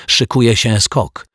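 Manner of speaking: high, eager announcement; keyed-up young sleuth; excited boy. A narrator's reading. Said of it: "krzyknął podekscytowany Dominik".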